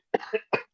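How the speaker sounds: sudden coughing fit; choking cough. Real, not acted. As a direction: cough hard, choking on water. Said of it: "I'm choking on water".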